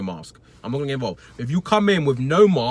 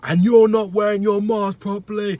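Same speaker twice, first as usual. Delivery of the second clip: higher, louder, half-strength shout; retold shout, held back; 7 semitones higher, 6 dB louder than usual. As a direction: shout it back but spare the mic. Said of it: "I'm not gonna shout as loud".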